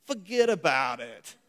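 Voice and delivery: Yankee translation. Pretend. Preacher giving the Yankee version of Paul, loud New York accent. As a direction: throw it off like a New Yorker. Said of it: "Let me give the Yankee translation".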